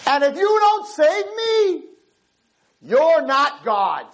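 slow, high, drawn-out ultimatum; defiant challenge to God. Retold or imitated. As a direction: dare God to act, word by word. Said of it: "he looked up and he said".

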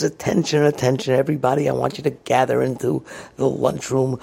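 announcer on the microphone. Retold or imitated. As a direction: play a boss calling everyone to attention. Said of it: "He gets on the microphone and he calls the entire factory".

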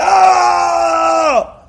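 loud high-pitched "ah" scream. Shown as a demonstration. Teacher showing how not to behave, screaming. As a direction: let out a long, high yell. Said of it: "We don't scream like".

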